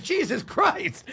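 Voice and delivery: high, slow mock shock; comic shocked at the kid's hospital story. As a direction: gasp it out in disbelief. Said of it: "I'm like".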